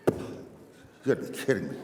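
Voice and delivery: put-on slow grumble from a grumpy old fellow; grumpy old man. Old fellow in the pew hears of the collection, grumbling. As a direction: grumble it slowly to yourself. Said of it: "He looked kinda grumpy".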